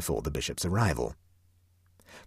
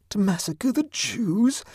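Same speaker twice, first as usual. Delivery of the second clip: much higher, slow outraged question; indignant; slower than usual. A narrator's reading. Huddle to Clovis, slow indignant disbelief. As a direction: stretch it out high in disbelief. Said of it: "said Huddle indignantly".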